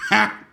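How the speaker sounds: high, sudden mock "ha!"; mimicked laugh. Waiter reacts to hearing it's a first date, mocking high laugh. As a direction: imitate a waiter bursting out laughing. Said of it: "he just goes".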